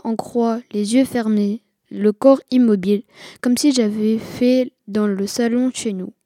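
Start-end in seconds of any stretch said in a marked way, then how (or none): none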